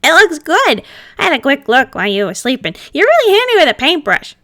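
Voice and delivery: pleased and perked up; brightened. Acted. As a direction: lift up with sudden delight. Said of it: "he did brighten a little".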